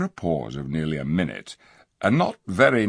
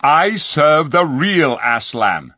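loud, slow and firm; angry; 8 semitones higher, 8 dB louder, slower than usual. A narrator's reading. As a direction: declare it with anger. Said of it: "said Tyrion angrily".

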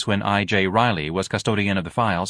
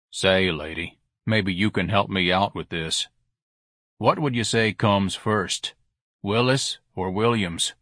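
dim clerk voice; slower than usual. Acted.

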